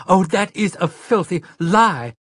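high, loud, furious outburst; outraged scream; screamed protest. A narrator's reading. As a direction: hurl the accusation in outrage. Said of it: "she screamed".

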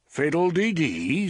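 airy southern-belle flutter; Scarlett O'Hara. A narrator's reading. Susanna mocks the wizard as a flighty belle. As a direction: toss it off like a belle. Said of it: "said Scarlet".

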